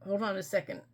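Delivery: pausing through hiccups. Real, not acted. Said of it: "I'm getting the hiccups".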